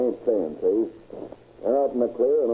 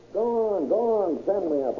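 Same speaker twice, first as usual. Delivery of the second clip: defiant taunt; 9 semitones higher than usual. Acted. Cornered suspect defies the police.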